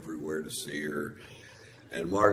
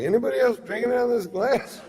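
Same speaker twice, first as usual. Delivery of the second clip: high, loud, fast, laughing disbelief; mock bewildered; 7 dB louder, faster than usual. Drunk comic wondering who emptied his glass, high loud laughing. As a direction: ask it like someone stole your drink. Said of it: "I'm like".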